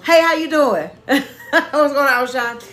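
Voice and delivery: a loud greeting that breaks into a laugh. Retold or imitated. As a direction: call out hello, laughing through it. Laughing greeting in told story.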